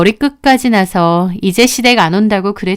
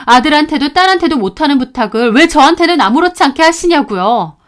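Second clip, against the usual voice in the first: plain reading voice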